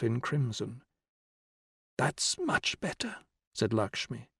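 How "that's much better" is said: put-on old woman whispering. A narrator's reading. Dying old woman voiced.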